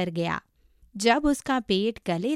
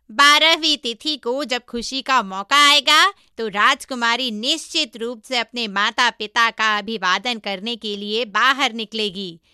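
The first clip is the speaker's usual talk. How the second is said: monkey disciple, confident scheming; 2 semitones higher, 5 dB louder than usual. Acted. Monkey proposing a plan to his master, confident. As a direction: lay out the plan loudly and brightly.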